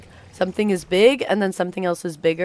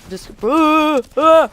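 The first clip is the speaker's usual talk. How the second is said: sudden high yelp of fright; real shriek as the car crashes; startled scream; 9 semitones higher, 8 dB louder, much slower than usual. Real, not acted. Streamer shrieking as her game car hits a tree.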